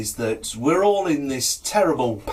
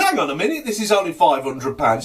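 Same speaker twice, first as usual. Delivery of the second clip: higher, louder objection; indignant protest; 5 semitones higher, 5 dB louder than usual. Acted. Doctor handed too little money, indignant protest. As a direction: cut in on being short-changed.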